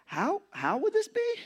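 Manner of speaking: high, slow, disbelieving question; incredulous Mary. Retold or imitated. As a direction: ask it in stunned disbelief. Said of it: "Can you hear the tone?".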